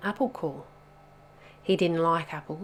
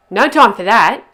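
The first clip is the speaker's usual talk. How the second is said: loud, higher, cutting snap; impatient snap; 4 semitones higher, 18 dB louder than usual. A narrator's reading. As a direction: cut him off sharply. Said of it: "snapped Bubba".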